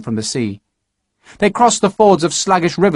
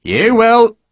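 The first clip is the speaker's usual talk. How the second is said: firm; 10 semitones higher, 5 dB louder than usual. Acted. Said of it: "Harper said".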